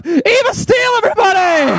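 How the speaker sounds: big game-show introduction; announcer shout. Acted. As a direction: introduce her like a star on stage. Announcing cohost.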